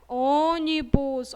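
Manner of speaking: Ônibus slow and loud, closed ô. Shown as a demonstration. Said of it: "vocês já fecham o som". (Ônibus devagar, ô fechado)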